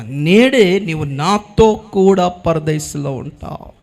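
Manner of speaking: slow, drawn-out proclamation; stately, stretched preaching delivery; solemn promise. Retold or imitated. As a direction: measure out each word like a promise. Christ's promise, proclaimed word by word.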